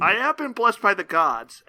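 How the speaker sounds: high mock-heroic proclamation; grandiose boast. Sidekick claims divine blessing to co-hosts, grandiose proclamation. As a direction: declare it like a chosen ruler.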